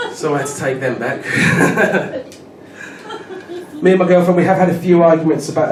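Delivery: ordinary talk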